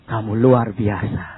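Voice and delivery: very low, slow, measured voice; low solemn pronouncement; Jesus. Retold or imitated. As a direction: speak deep and unhurried, bestowing a blessing. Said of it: "Berhenti dan bilang".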